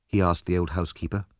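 usual speaking voice

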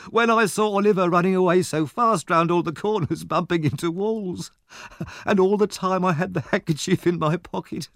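put-on high, giggling street boy; gleeful boy. A narrator's reading. Charlie Bates to the Dodger, high and gleeful. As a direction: tell it bursting with glee. Charlie laughing retelling the chase.

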